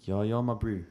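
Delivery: short South African "my bru" impression; South African accent. Copying DiCaprio in Blood Diamond, South African accent. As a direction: try South African like a film actor. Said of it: "He did it there".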